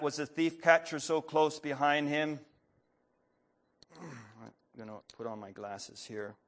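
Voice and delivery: ordinary talk, nothing marked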